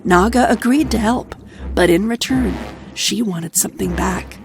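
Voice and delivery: plain reading voice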